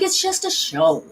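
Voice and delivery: high, drawn-out protest; retold high-pitched exasperation; retold exasperated protest. Retold quarrel with ex.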